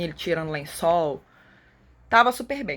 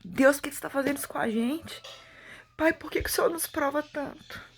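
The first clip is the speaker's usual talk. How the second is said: anguished pleading prayer; 6 semitones higher than usual. Retold or imitated. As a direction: voice pitched much higher, pleading with God in distress. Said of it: "comecei a orar e falar". (oração aflita e suplicante)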